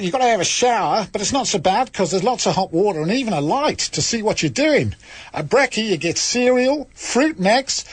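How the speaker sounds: a hammed-up, over-the-top Australian accent. Host reading a listener's letter to radio listeners, in a bad Australian accent. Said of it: "what do you think to the accent".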